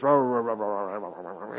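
low grumble fading to almost nothing; mock cartoon grumbling that dies away; Popeye murmuring. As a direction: mumble under your breath like old Popeye. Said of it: "He was a murmurer".

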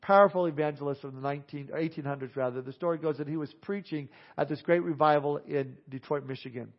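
full-voiced revival storytelling sermon; preaching. Evangelist preaching a conversion story to his church, full voice. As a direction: preach the story out loud to the congregation.

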